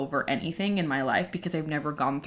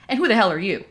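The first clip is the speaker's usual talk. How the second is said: indignant; 3 semitones higher, 6 dB louder, faster than usual. Retold or imitated. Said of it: "I was like".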